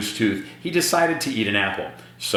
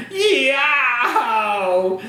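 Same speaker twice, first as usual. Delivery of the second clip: put-on sudden high, loud child's "Yow!"; a hammed-up little boy's yelp; yelp of pain; 4 dB louder than usual. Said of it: "took a bite and yelled".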